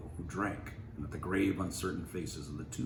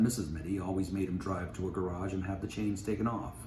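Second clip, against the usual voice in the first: usual speaking voice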